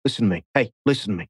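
a hint of Kyle's hushed coach talk; Kyle Chandler impression. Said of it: "How Kyle used to start a scene by going".

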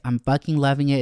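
ordinary delivery

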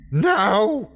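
high, anguished scream. A narrator's reading. Crazed man scrambling to his feet, screaming. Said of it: "he screamed".

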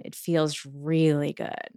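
"really" leaned on, slow and soft; emphasis on really. Shown as a demonstration. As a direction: stress "really" slowly. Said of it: "put the emphasis on".

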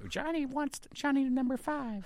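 high robot impression. Buddy riffing on chatbots, squeaky robot. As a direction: pipe up like a toy robot.

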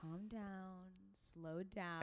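very soft, settling yoga-teacher voice. Shown as a demonstration. Host showing her yoga class voice, very soft. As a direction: ease it right down, hushed and soothing. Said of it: "What was your yoga voice?".

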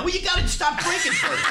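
high-pitched mock scolding; exasperated scolding. Prank victim telling drunk caller off, exasperated scolding. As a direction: imitate a flustered man lecturing, pitch way up.

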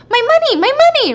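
high, loud mock wail; kid's demanding shout. Kid demanding payment from Sophie, high shouted plea. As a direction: cry out for it like a cheated kid.

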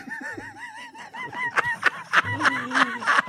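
high burst of laughter. Real, not acted. Laughing during impression.

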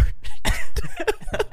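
a high, breathless burst of laughter. Real, not acted. Laughing at his own bad behavior with the shirt.

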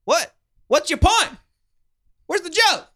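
very high, pushy heckler voice; hammed-up high-pitched heckling; mock badgering. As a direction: demand answers in a silly high yell. Joins the mock hectoring bit.

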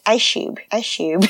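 imitating a toddler, "Ice cube" as eye-shoob; toddler pronunciation. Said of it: "also says ice cube like this".

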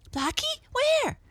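high, rising startled questions; surprised boy. A narrator's reading. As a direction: ask high and loud, astonished. Brother startled by the news.

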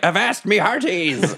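high, drawn-out pirate call; stretched-out, high-pitched pirate hail; pirate cry. Acted. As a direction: holler like a hammy pirate. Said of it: "I'm playing pirate".

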